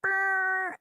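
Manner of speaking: high rolled doorbell "brrr"; imitated doorbell buzz. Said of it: "All of a sudden you hear".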